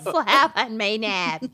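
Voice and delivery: slow echo in Minnesota drawl; Minnesotan accent. Pretend. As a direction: copy the Minnesota vowels. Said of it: "worst Minnesotan accents".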